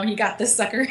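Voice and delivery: normal talking voice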